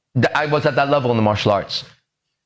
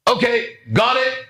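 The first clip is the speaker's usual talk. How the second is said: class teacher; 7 semitones higher, slower than usual. Retold or imitated. Acted teacher ending the chanting.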